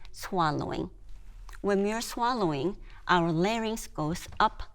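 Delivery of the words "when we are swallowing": ordinary talk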